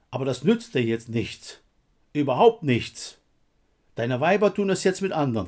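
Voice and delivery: heated jeer, building to hammered final denials; bitter, punchy put-down; scornful taunting. A narrator's reading. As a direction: rub it in, harder on each short phrase. Taunting a comrade in quarrel.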